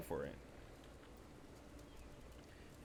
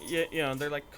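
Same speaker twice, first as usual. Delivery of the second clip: ordinary delivery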